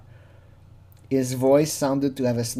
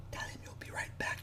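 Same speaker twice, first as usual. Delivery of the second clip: hushed unvoiced whisper; imitated whisper; 21 dB quieter than usual. Whispering to the decoy what to say, imitated whisper. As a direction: whisper it as a secret prompt. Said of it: "she was whispering".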